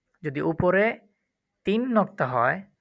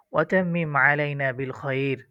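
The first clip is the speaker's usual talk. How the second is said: usual speaking voice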